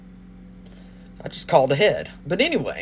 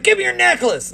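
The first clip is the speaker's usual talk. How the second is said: mock robber demand; 14 semitones higher, 5 dB louder than usual.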